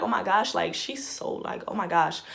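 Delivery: soft breathy disbelief; hushed shock. Retold or imitated. Watching Giannia yell at him, hushed disbelief. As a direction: murmur oh my gosh under your breath.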